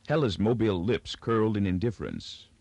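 ordinary delivery

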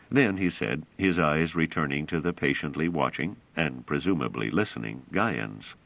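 plain reading voice

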